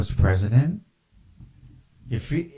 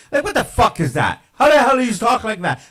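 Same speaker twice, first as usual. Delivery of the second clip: high, fast, loud disbelief; outraged shout; 11 semitones higher, 6 dB louder, faster than usual. Real, not acted. Ranting at his viewers about Obama, an outraged shout. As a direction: yell it in outrage.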